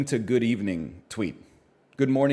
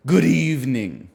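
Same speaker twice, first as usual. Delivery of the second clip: Vincent Price haunted-house welcome. Acted. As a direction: welcome them into a haunted house, old horror-film style. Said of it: "in a voice like Vincent Price, welcoming someone to, like, a haunted house".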